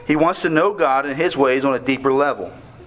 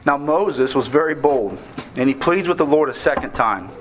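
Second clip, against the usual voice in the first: usual speaking voice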